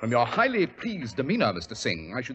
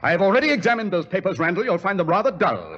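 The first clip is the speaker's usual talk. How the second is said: louder, stepping out of hiding; sudden loud reveal; 5 semitones higher, 5 dB louder than usual. Acted. Holmes confronting Randall at the desk, loud. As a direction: catch him off guard.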